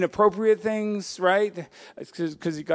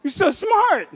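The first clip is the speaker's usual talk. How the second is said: loud whoa sliding way up, laughing; hammed-up awe at a clever kid; amazed praise; 4 semitones higher, 7 dB louder, much slower than usual. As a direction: gush like you're blown away. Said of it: "It's not like".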